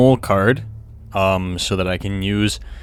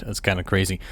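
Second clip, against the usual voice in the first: ordinary talk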